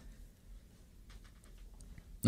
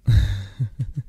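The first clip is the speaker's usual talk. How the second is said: light, bubbling little laugh; amused giggle. Acted. Coaxing a clingy partner to let go, amused giggle. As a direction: laugh at being held on to.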